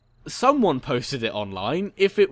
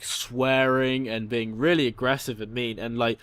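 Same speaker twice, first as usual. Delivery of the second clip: ordinary talk, nothing marked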